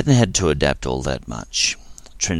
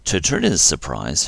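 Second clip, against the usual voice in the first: ordinary delivery, nothing marked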